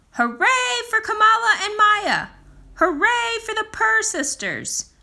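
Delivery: high, loud celebratory cheer; storyteller's joyful hooray. A narrator's reading. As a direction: cheer them on with full delight. Cheering the sisters' success.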